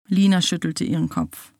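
plain reading voice

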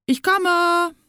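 high, drawn-out seeker's call. A narrator's reading. Leon finishes counting in hide-and-seek, calling out. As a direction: call loudly through the woods to the hiders. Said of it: "nach einer Weile ruft er".

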